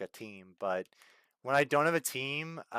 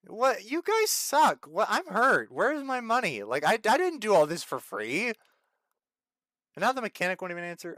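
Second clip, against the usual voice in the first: high, louder mock-wounded whine; hurt whine; 7 semitones higher, 4 dB louder than usual. Real, not acted. Streamer scolding the game for not paying, mock hurt. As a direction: play the betrayed victim. Ranting about stolen game pay.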